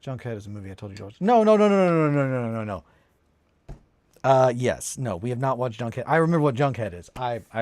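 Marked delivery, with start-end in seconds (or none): 1.2-2.8 loud no's fading down; emphatic denial. Real, not acted. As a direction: protest hard then let it trail off. Protesting she has watched it.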